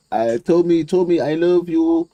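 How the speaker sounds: high, slow Iranian accent mimicry; slow broken English in a mock Iranian accent. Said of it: "in his Iranian accent".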